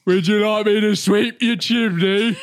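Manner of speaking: put-on hamming up a British accent to charm American women; flirty British accent. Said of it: "I'll lean into my accent".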